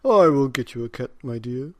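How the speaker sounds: a hint of a doting old husband; old man. A narrator's reading. Old man promises his wife a cat, lightly voiced. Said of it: "said the very old man".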